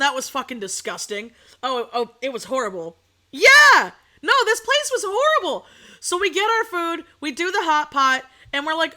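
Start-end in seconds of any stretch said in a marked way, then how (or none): 3.3-5.7 1 loud outburst. Real, not acted. Loud agreement.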